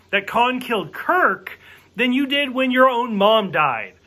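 ordinary talk, nothing marked

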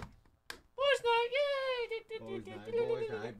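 very high, giddy falsetto chant; squealed cheer. Pretend. Cheering for boys' night, high squeals.

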